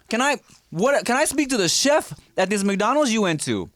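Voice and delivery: mock fussy customer asking for chef; high-pitched customer demand.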